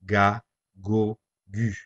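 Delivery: "Ga, go, gu" each with a hard G as in go; hard G syllables. Shown as a demonstration. Teacher demonstrating the hard G before a, o, u. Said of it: "the G will be pronounced as G".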